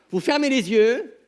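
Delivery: ordinary talk, nothing marked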